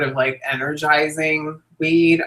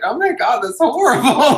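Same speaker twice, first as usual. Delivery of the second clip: mock sympathy through laughter; 8 semitones higher, 3 dB louder than usual. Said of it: "I'm like".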